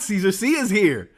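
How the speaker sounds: bright, eager announcement; excited. Retold or imitated. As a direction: read it like a thrilled fan. Reads chat excitedly, first take.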